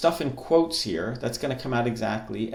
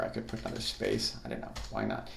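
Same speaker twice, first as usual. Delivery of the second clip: muttered aside; 10 dB quieter, faster than usual. Real, not acted. Muttered aside while typing.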